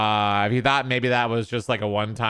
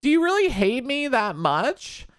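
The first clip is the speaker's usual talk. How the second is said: put-on high-pitched pleading child voice; imitated little kid; 12 semitones higher than usual. Kid who saw the video asks mommy, high mock child voice. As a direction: play a hurt kid questioning mommy, very high. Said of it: "the kid and seeing this".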